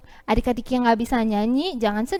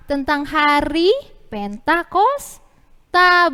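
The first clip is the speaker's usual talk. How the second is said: slow, louder prompting answer; drawn-out answer; 3 semitones higher, 6 dB louder, much slower than usual. Shown as a demonstration. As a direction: stretch it out for kids to follow. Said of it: "Ceritanya tentang apa?".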